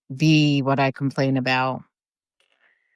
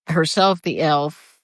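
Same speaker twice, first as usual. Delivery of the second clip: normal talking voice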